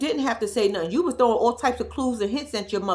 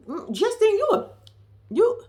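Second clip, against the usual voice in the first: high mock scold; 10 semitones higher than usual. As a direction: tut at her in a high squeaky voice.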